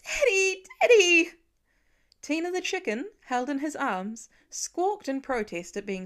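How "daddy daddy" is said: put-on high, loud sobbing cry of a child; crying child runs to his father; blubbering child. A narrator's reading. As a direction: wail it like a frightened little boy. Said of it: "already blubbering".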